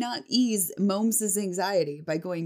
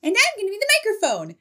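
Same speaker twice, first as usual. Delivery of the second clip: high, fast, chirpy mock teacher voice; teacher's silly announcement; 6 dB louder, faster than usual. Imitating the teacher announcing to a nervous student, high and fast. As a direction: play the teacher bouncing with sudden enthusiasm. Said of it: "my teacher goes".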